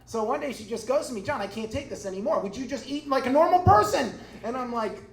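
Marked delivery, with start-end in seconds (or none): 1.2-4.1 mock outburst, building to a shout; exasperated aunt. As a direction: act out an aunt snapping, louder and higher. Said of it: "it's been driving her nuts".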